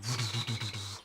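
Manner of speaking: stuttering buzzed whoosh of shadows; shadow noise. Acted. Game master imitating shadows moving all around, stuttering noise. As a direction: imitate shadows flitting past with a rattly noise. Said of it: "you see shadows going".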